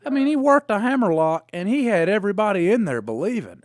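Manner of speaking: excited. Real, not acted. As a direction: hammer home how impressed he was. Repeats with enthusiasm.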